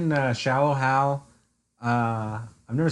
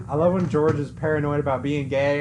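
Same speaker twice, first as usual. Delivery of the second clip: ordinary talk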